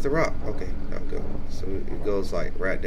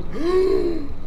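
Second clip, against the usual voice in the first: sudden high gasp; sharp high gasp. Real, not acted. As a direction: gasp in fright. Monster returning.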